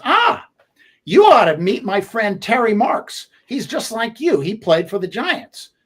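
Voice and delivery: eager East Coast guy making an introduction; imitating Joe's East Coast accent. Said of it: "He goes".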